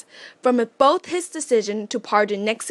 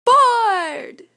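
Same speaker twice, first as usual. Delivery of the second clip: excited shout; 11 semitones higher, 5 dB louder than usual. Acted. Child shouts answer to quiz.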